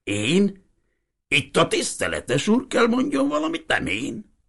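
put-on a startled old man throwing it back; taken aback. Old Hiribi surprised, turning the question back to the priest. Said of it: "Az öregember meghökkent".

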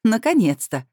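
high, loud, delighted exclamation; gleeful relief, slightly put-on; joyful. A narrator's reading. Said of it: "радостно добавила".

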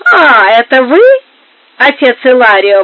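loud, startled cry with a mild tremble; trembling exclamation. A narrator's reading. Duchess startled by her husband's confessor, exclaiming in a trembling voice. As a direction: exclaim in alarm, voiced by a narrator. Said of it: "воскликнула она дрожащим голосом".

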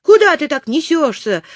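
high, loud panicky cry; alarmed shout. A narrator's reading. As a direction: shout a warning at a reckless driver. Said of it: "кричал Незнайка".

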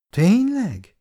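surprised question. A narrator's reading. Wife asks back in surprise.

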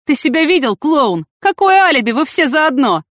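high-pitched, loud jeering; derisive scoffing. A narrator's reading. Lieutenant scoffing at the suspects' alibi, derisive. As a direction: ridicule them, pitch leaping up. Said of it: "хохотнул лейтенант".

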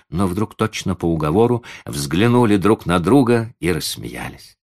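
ordinary delivery

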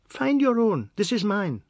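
put-on high, loud child's voice; defiant little boy. Anand refuses to give money back, defiant boy. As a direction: refuse in a high-pitched boy's voice.

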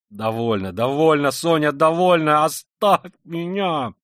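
high, loud, convulsive plea; anguished cry. Acted. Killer begging Sonya to stop, in anguish. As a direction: build to a drawn-out desperate shout. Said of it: "вскричал он вдруг в судорожной тоске".

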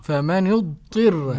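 "فمن اضطر" slowly as famanu-dtirra; i on the ta in "فمن اضطر", drawn out; Abu Ja'far reading. Shown as a demonstration. Presenter echoing the sheikh, slow famanu-dtirra. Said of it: "ضم النون وكسر الطاء".

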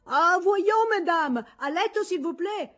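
high, loud scolding governess with French flair. Acted. Governess calls the chattering girls to bed, high and loud. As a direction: call the girls to bed like a fussy French mademoiselle. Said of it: "si fece sentire Mademoiselle Popinet".

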